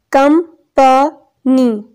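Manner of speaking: कंपनी split slowly into कं, प, नी; syllable by syllable. Shown as a demonstration. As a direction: say कंपनी in three separated syllables. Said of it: "ये three इसके syllables हैं". (کمپنی کو تین حصوں میں ٹھہر ٹھہر کر: कं, प, नी)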